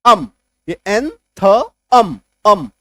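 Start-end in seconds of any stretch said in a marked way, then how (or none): 1.9-2.7 अम said twice, loud, as a short "um" sound; a loud repeated "अम, अम" to show the hidden vowel; sound demo. Shown as a demonstration. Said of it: "अम का आपको sound आएगा". ("अम" को ज़ोर से दो बार बोलिए)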